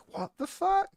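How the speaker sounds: high squeaky what the fuck; shocked disbelief. Retold or imitated. Viewer reacting to the Dalai Lama news in shock. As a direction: gasp it out in stunned disbelief.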